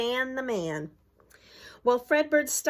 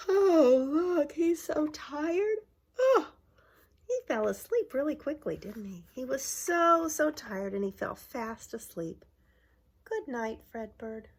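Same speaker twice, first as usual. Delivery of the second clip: tender goodnight; 6 semitones higher than usual. Acted. Mascot falls asleep.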